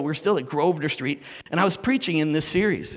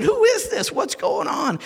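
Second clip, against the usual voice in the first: awestruck cry; 5 dB louder, slower than usual. Retold or imitated. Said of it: "he said".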